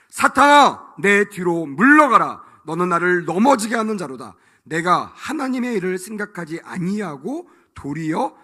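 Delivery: loud, commanding shout; Jesus rebuking Peter, loud and commanding; stern rebuke. Acted. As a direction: thunder it at Satan.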